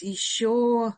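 normal talking voice

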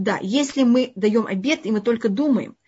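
usual speaking voice

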